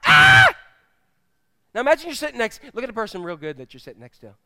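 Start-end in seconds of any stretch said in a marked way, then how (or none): 0.1-0.5 high, full-force acted scream; sudden yell. Man in the congregation interrupting the teacher, sudden acted scream. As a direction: burst out with a piercing yell. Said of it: "this man yells out".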